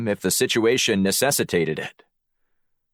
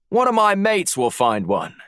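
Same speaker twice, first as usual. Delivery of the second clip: much higher, matey Aussie voice; Australian accent; 10 semitones higher than usual. A narrator's reading. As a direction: lay on a put-on Australian accent. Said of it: "summoned his best Australian accent".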